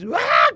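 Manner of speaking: a short sharp yell of fright; a quick retold cry calling for help; retold scream. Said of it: "dei aquele berro".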